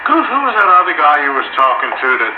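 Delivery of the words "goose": ordinary talk, nothing marked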